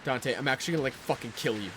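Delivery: usual speaking voice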